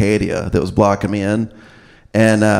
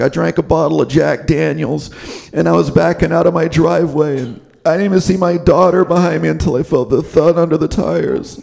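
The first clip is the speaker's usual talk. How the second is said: higher, mock-solemn confession voice; AA confessional; 5 semitones higher than usual. Man sharing his story at the AA meeting, in a mock solemn voice. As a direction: tell a tragic story at a meeting, mock sombre. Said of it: "He's, like, talking".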